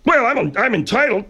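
high, protesting comic voice; high entitled character. Acted. Bud insisting to Margaret that he is entitled, high and indignant. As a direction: protest in a silly high-pitched voice.

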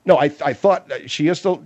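ordinary talk

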